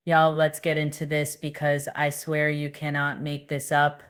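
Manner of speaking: normal talking voice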